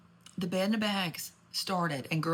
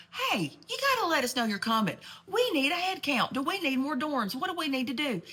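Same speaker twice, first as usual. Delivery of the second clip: voiced officials; 7 semitones higher than usual. Storyteller voicing the school asking students to call ahead, mildly higher. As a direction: play the school asking for a head count.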